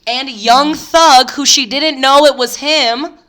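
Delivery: loud, slow, drawn-out reveal; big dramatic reveal; emphatic. Real, not acted. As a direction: land the name hard.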